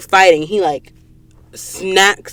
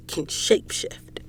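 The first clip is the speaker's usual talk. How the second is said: whispered reveal; 13 dB quieter than usual. Real, not acted. Vlogger revealing the shapeshift twist to viewers, whispered. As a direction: drop to a hushed whisper.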